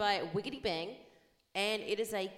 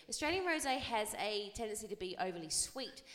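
ordinary delivery

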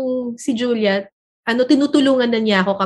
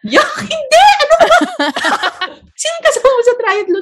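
much higher, laughing shout of disgust; laughing yelled denial; 3 dB louder than usual. Retold or imitated. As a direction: recoil and protest, cracking up. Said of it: "Sabi ko". (natatawang sigaw na pagtanggi, mataas ang boses)

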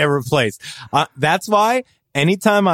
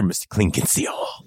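lower, much softer mock-ominous line; ominous announcer; 8 semitones lower, 7 dB quieter than usual. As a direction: drop into a mock-ominous trailer voice. Ominous punchline about Mister Clean.

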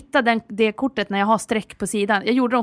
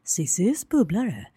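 jingle announcer; 5 semitones lower, much slower than usual. Acted. Announcing a bonus segment.

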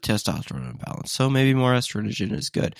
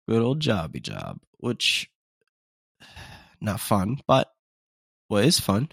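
ordinary talk

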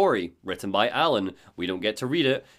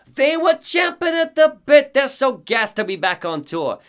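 hyped manager; 12 semitones higher, 7 dB louder than usual. Acted. Host voices Barry the manager.

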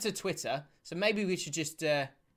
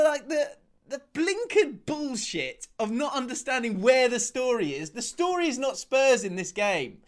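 furious rant; 5 semitones higher than usual. Real, not acted. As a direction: vent at the commentators with real fury. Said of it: "I'm gonna go on a rant".

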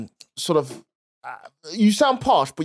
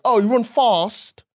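slow, higher Londoner with long "ah" in "fast"; mock London accent; 5 semitones higher, slower than usual. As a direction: mimic a Londoner, drawn-out southern vowels. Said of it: "I hate when Londoners".

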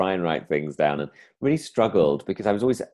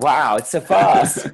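loud, high, drawn-out wonder; hammed-up amazed cry; mock amazement; 8 semitones higher, 8 dB louder, much slower than usual. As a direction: act out an astonished audience. Acting out amazed audiences.

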